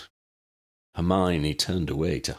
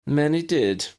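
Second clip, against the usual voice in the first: plain, brief, louder high answer; simply; 10 semitones higher, 5 dB louder than usual. A narrator's reading. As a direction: state it without fuss. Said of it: "she said simply".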